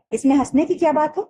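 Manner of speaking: high, irritated demand from the father; angry father. Acted. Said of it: "पिताजी को गुस्सा आ गया".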